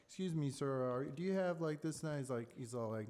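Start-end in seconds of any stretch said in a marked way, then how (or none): 0.1-2.0 higher, louder mock-polite opener; retelling a movie salesman's nervous pitch. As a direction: lightly play a timid cold caller. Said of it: "he was like".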